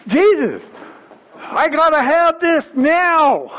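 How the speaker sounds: high, insistent acted plea; desperate beggar pushing through; pressing in. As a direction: demand it urgently, refusing to be put off. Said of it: "He's pressing in".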